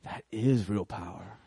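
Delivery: dazed repeat; slow, low awed echo. Retold or imitated. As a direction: echo it back slowly in a low, stunned voice. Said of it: "And he was like".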